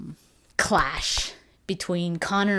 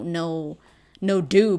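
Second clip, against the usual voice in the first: ordinary talk, nothing marked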